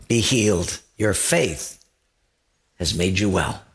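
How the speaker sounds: Jesus speaking gently to the healed man; gentle. Retold or imitated. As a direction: speak it tenderly and low. Jesus heals, voiced low.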